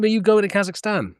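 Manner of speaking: normal talking voice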